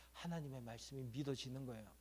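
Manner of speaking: a soft, breathy secret; whispered. Real, not acted. Preacher hushes a key line. (속삭이듯)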